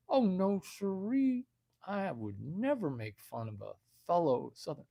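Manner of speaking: softly swooping mock Southern accent; phony Southern gentleman's accent; fake Southern accent. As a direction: deny it in a put-on Southern accent. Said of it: "Are you making fun of my accent?".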